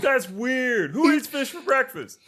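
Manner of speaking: very high mock-incredulous writer; put-on writer voice. Writers puzzled by fish for breakfast, high put-on voice. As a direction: squeal it as a silly writer. Acted writers answering the director.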